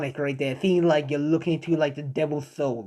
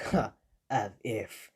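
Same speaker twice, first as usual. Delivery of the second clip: low, soft, slow scoff; understated smug dismissal; dismissive; 8 semitones lower, 10 dB quieter, slower than usual. Acted. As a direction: brush the threat off coolly. Shadow dismisses villain's threat.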